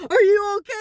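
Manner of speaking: high, loud frantic question; alarmed shout. Retold or imitated. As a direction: yell it over her face. Grandson standing over her.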